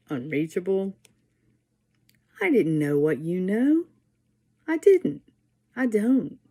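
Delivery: measured, deliberate poem recital with pauses; poetry reading. Acted. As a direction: read each line like a poem for an audience. Reads her own poem aloud.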